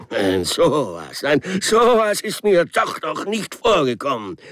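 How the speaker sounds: high, loud indignant outburst, out of breath; the railway man fuming and panting at the mess; empört keuchend; angry panting. Acted. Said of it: "keuchte wütend".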